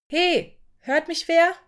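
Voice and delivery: high, loud call into the empty hall; a young worker calling for help, high and loud. Acted. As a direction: shout to be heard across the room. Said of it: "rief er".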